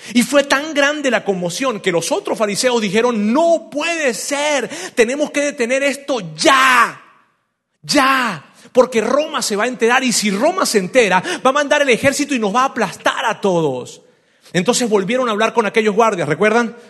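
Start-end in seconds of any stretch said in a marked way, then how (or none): 3.3-14.0 alarmed outcry. Retold or imitated. Pharisees shouting in panic to each other, urgent outcry. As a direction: sound the alarm, shouting it's got to stop now.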